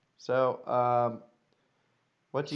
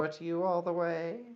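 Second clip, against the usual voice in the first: sarcastic courtesy; 5 semitones higher than usual. Acted. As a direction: lift the polite question with veiled sarcasm. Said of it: "Not with that voice".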